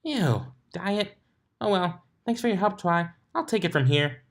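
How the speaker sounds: high, disgusted then cheery; Pinkie, grossed out. A narrator's reading. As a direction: wrinkle your nose, then brighten. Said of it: "Pinkie grimaced".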